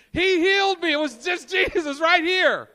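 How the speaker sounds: high, loud, bursting with joy; excited shout. Acted. Healed man telling everyone, in a high excited shout. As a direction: shout it out like you can't hold it in.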